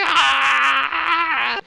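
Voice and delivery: high loud pained monster yell; a wounded ghoul crying out; pained ghoul shriek. Acted. Ghoul gets hit, cries out.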